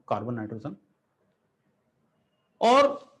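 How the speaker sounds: normal talking voice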